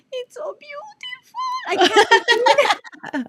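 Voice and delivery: high, loud, laughing shout of wonder; a laughing shout at her own art; laughing cry of joy. Retold or imitated. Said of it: "And I said".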